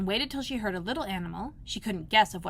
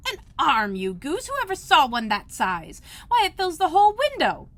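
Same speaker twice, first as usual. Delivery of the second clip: high, louder exasperated scolding; Rabbit's voice; 7 semitones higher, 7 dB louder than usual. A narrator's reading. Rabbit scolding Pat, exasperated and louder. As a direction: scold a dim servant.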